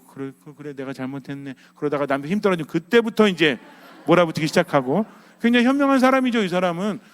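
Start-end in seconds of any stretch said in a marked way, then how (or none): 0.0-1.6 low, very soft giving-in; meek concession. Acted. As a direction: act a wife quietly backing down. Wife meekly giving in to husband. (낮고 작은 소리로 순순히 수긍)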